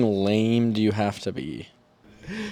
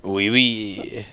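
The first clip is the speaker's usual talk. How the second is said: put-on French accent; 5 dB quieter than usual. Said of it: "Pink Panther trying to speak French".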